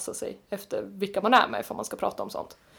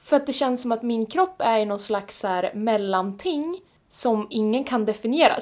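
ordinary delivery

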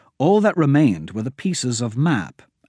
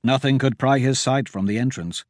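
usual speaking voice

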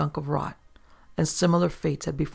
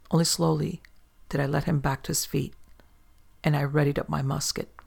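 ordinary delivery

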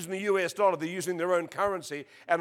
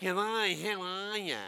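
nasal Australian accent; 3 semitones higher, slower than usual. Shown as a demonstration. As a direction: say it through the nostrils, broad Australian. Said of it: "through the nostril they say it".

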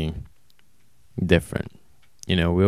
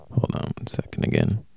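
whispering; 14 semitones lower, 5 dB quieter than usual. Real, not acted. Aside while checking texts.

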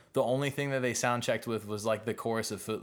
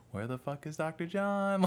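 confused bandmate; 6 semitones higher than usual. Retold or imitated. Bandmates lost, missing singer.

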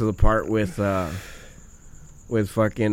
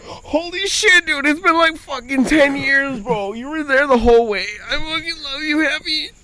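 high, weepy mock outpouring; squeaky, choked-up wail; crying; 12 semitones higher than usual. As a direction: gush it through exaggerated tears. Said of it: "crying, whatever".

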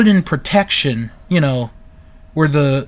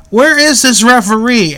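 louder, higher quoted call; retold commentator question; 6 semitones higher, 6 dB louder than usual. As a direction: quote the commentator calling out, voice raised. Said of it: "Gorilla is like".

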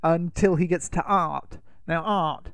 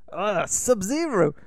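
higher, slow theatrical villain voice; villain impression; 6 semitones higher than usual. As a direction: drawl it out like a scheming sorcerer. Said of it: "Shang Tsung on the boat says".

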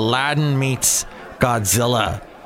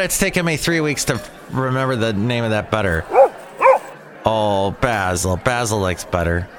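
usual speaking voice

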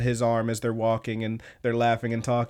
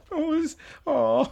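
touched aww; 13 semitones higher, slower than usual. Real, not acted. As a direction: coo at something adorable. Moved by cute scene.

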